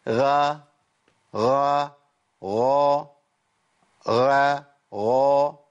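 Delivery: غا repeated, each full and thick; repeated heavy Ghain. Shown as a demonstration. Teacher drills students on heavy غا. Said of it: "فتبقى إيه؟".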